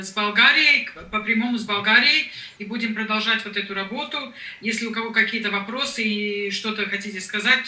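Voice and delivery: ordinary talk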